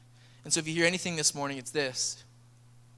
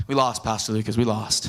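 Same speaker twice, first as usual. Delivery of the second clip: put-on low, moping little-kid voice; glum kid; 8 semitones lower than usual. Kid tells Pastor Lucas they lost, moping. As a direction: play the sulky loser kid. Kid sulking after losing game.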